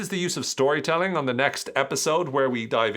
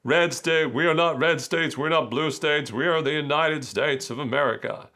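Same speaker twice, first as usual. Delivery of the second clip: mock Obama speech accent; 4 semitones higher than usual. Lecturer quoting Obama to students in an exaggerated put-on accent. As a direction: play Obama rousing a crowd with an exaggerated accent. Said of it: "he had nothing like that type of accent".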